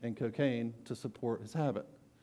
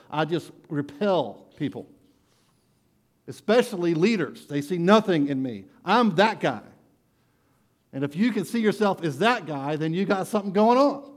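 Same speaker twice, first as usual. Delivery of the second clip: louder, higher confession of brokenness; full-force preaching; 5 semitones higher, 7 dB louder than usual. Acted. Preacher voicing the broken sinner for the congregation, full-force preaching. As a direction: cry it out like a sinner owning it.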